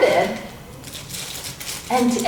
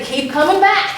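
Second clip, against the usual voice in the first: quoting a slogan; 6 semitones higher than usual. Retold or imitated. Coach quoting the twelve-step motto, higher and brighter. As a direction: pitch it up like a cheerful motto. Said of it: "twelve-step programs will say".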